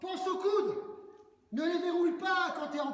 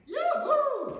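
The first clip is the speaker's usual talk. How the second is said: excited cheer; 10 semitones higher, 7 dB louder than usual. Real, not acted. Coach cheering during workout.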